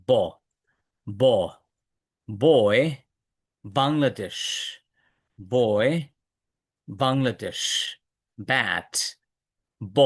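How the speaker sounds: "ব, বয়, বাংলাদেশ" with both lips closed and no puff on the b; b without air. Shown as a demonstration. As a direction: hold back the breath on each b of "বয়, ব্যাট", said low and separately. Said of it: "এবার বাতাসটা বের হবে না".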